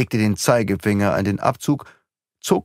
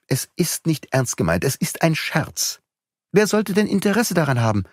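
Beautrelet's voice; 7 semitones higher than usual. A narrator's reading. Beautrelet reassures mother.

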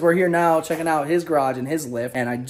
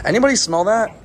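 loud mock announcement; 7 semitones higher, 6 dB louder than usual. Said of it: "where I embarrass her".

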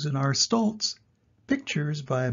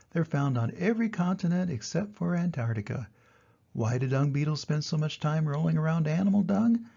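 usual speaking voice

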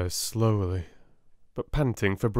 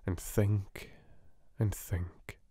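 fading whisper; 2 semitones lower, 6 dB quieter, slower than usual. A narrator's reading. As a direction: trail off into thought. Narration fading out.

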